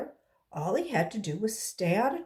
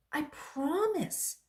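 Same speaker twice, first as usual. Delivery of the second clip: pigtail voice; 8 semitones higher, much slower than usual. Acted. Pigtail voice reassuring Paul.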